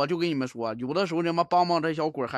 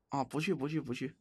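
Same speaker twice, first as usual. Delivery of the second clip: much softer, yielding murmur; soft giving in; 6 semitones lower, 10 dB quieter than usual. Real, not acted. Streamer soothing the ghost Xiaohong, soft and yielding. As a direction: give way to her gently and quietly.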